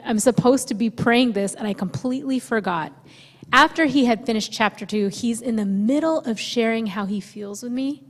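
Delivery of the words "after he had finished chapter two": normal talking voice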